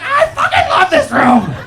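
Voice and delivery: a dog thrilled by the room; overjoyed dog. Pretend. Dog shouting its love for the living room, overjoyed.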